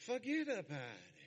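put-on New Jersey Italian swagger; hammed-up Jersey Italian accent. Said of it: "That's my New Jersey Italian accent".

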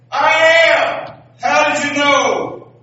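high loud surprised shout; shouting back. Acted. Balloonist yelling down to the lady, high and surprised. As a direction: call it down, startled and loud.

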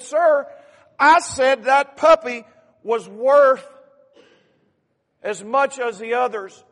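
slow, very loud, high declaration; loud earnest insistence. Acted. Boy to store owner, loudly repeating the puppy's worth. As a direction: drive the point home and do not budge.

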